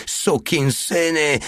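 high, drawn-out angry curse; a raging woman's shriek, acted by a narrator; furious shrieking. A narrator's reading. Fat woman at smashed window cursing soldiers, furious shriek. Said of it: "wrzeszczała wściekle".

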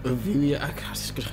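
whispering. Real, not acted. Says the name softly.

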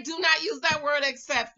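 ordinary talk, nothing marked

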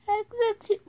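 lighter echo of the order; repeated command. Pretend.

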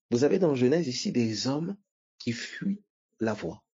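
ordinary talk

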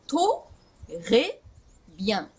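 "ト、レ、ビアン" broken into pieces, a full vowel after T; over-separated syllables. Shown as a demonstration. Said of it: "このRを意識したときに".

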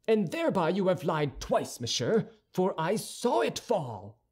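high-pitched accusation; quarrelsome young Gascon. A narrator's reading. D'Artagnan accusing Aramis of lying, quarrelsome.

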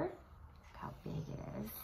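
soft unvoiced whisper. Real, not acted. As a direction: hush it in awe. Whispered awe.

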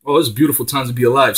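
normal talking voice

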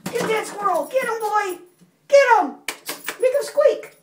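high, punchy play commands; egging a pet on. Real, not acted. Mommy urging River at the squeaky squirrel, excited pet talk. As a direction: urge the animal to pounce.

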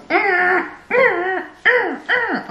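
high excited mm sounds; happy humming. Retold or imitated. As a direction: squeal little mms up high. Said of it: "He was just like".

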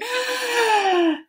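sharp high gasp of shock; big mock gasp. Shown as a demonstration. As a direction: gasp as if startled.

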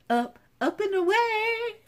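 very high, playful read-out; high-pitched delighted lilt; playful squeal. Real, not acted. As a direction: coo it high, stretching the last word. Reading a cutout, delighted.

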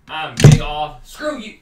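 loud angry outburst. Real, not acted. Gamer yelling at teammate before quitting, angry. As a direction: yell it as you quit.

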